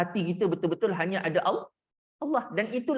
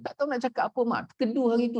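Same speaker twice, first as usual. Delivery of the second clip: ordinary talk, nothing marked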